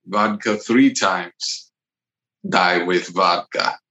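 put-on Russian accent. Improviser recites a vodka saying as a cosmonaut, Russian accent. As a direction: recite folk wisdom in a heavy Russian accent. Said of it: "I'm gonna make it Russian".

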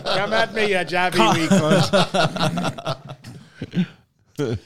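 a rowdy Scottish drunk in light imitation; Scottish accent. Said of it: "You gotta, you gotta say that in the accent".

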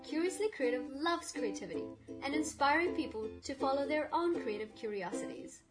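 bouncy scripted podcast intro; sing-song welcome over music; exaggerated cartoon intonation.

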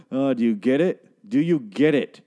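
pointed, emphatic repeat; mock nudge at the listener; heavy-handed mocking. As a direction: hammer it home sarcastically. Mocking the show's heavy-handed hints.

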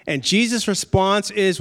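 normal talking voice

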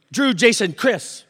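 mock exasperated call, high and loud; frustrated name-calling. Staffer imitates frustrated boss calling out names, loudly. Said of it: "we know when you're frustrated".

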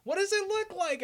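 high, light quoted voice. Retold or imitated. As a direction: pitch it up to play her. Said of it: "she's like".

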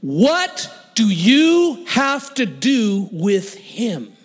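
very slow, heavily punched demand; slow-motion challenge. Retold or imitated. Gang guy challenges the churchgoer about Wayne, slow emphatic demand. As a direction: stretch and hammer every word.

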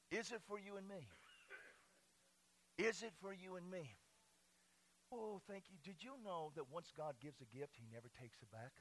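very soft, intimate preaching; hushed. Real, not acted. Pastor asks church about God's gift, hushed. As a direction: drop right down to a hush. Sudden hush after the whoop.

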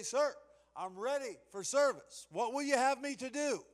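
high, eager salute voice; stiff barking toy soldier. Pretend. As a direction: report for duty at attention. Soldier ready for service.

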